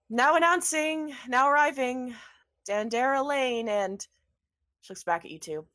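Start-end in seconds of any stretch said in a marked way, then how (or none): 0.1-3.7 loud, higher herald's proclamation; formal announcement. Acted. Tall woman announcing the guests in a loud herald voice. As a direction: announce them grandly to the hall.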